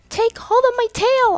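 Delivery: high, loud shout to the drowning pair. A narrator's reading. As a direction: yell it out over the water. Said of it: "I shouted".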